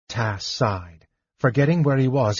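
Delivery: ordinary delivery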